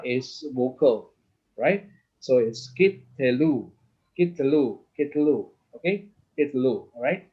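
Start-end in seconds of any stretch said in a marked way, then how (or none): 2.7-3.7 kit telu with the first syllable closed on kit. Shown as a demonstration. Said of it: "this is how you pronounce the word". 4.1-5.5 kit telu with the first syllable closed on kit. Shown as a demonstration. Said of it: "this is how you pronounce the word". 6.4-6.9 kit telu with the first syllable closed on kit. Shown as a demonstration. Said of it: "this is how you pronounce the word".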